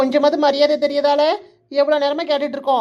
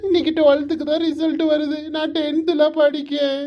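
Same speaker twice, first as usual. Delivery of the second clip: acted bawling of an upset son; wailing; 3 semitones higher than usual. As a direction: sob between the phrases. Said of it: "இப்படி கிடந்து அழுதுகிட்டே இருக்காத".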